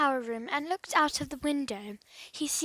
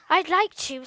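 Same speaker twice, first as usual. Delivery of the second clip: with a sigh; 4 semitones higher, 8 dB louder than usual. Acted. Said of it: "said Aunt Polly with a sigh".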